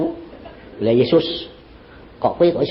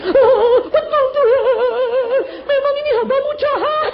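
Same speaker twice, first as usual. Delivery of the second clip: mock sobbing confession; slower than usual. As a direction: wail your confession to God. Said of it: "Nangisnya dua kali lipat".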